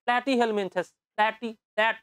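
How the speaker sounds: teacher playfully mimics the Feni accent; Feni-style pronunciation. Shown as a demonstration. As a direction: say "ফ্ল্যাটি", "ফ্ল্যাট" the Feni way, P turned to F. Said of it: "ফেনীবাসী হয়ে আমরা এইভাবে উচ্চারণ করবো".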